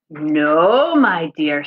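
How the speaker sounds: mother mole reassuring, lightly voiced; touch of a mama mole voice. Mama voice in story.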